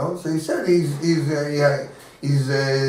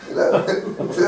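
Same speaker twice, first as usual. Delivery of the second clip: ordinary delivery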